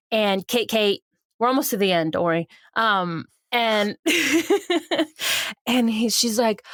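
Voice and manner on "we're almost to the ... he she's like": ordinary talk, nothing marked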